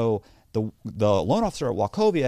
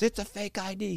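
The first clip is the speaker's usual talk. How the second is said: higher, put-on accusing bank man; angry security man; 9 semitones higher, 5 dB quieter than usual. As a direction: snap back that he's lying. Bank man voiced again.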